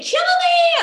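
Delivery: screaming into the canyon to be killed. Retold or imitated. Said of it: "So I started screaming".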